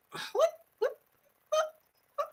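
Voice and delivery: tiny high-pitched, broken-up whats; mock disbelief. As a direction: squeak it softly, tipping into laughter. Mock disbelief, ends laughing.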